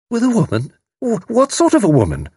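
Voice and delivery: very high, astonished questioning. A narrator's reading. Mother questions the servant about the woman, astonished. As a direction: repeat it in high-pitched astonishment, stumbling into the question. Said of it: "Missus Sprott said with astonishment".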